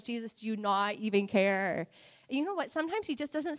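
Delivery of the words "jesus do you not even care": 2 imitating tearful prayer. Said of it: "I'm like, crying in my car".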